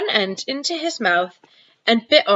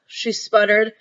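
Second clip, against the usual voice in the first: ordinary delivery, nothing marked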